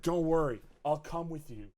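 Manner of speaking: normal talking voice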